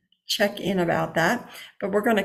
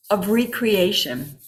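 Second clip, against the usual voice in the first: usual speaking voice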